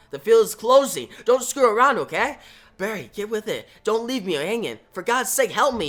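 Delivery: panicked plea. Acted. Trapped hero pleads with his mentor, panicked. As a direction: beg him not to leave you trapped. Wally trapped, begging Barry.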